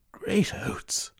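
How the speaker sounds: a startled, unvoiced gasp of words; shocked whisper. Velvein reads of war in the paper and whispers in shock. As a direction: breathe it out in alarm.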